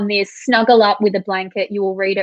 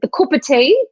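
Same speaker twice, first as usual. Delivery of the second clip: imitated northern English accent, higher pitched; 6 semitones higher, 3 dB louder than usual. Host imitating husband's northern English, playful northern accent. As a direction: say it like a northerner from the Isle of Man. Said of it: "the northern accent".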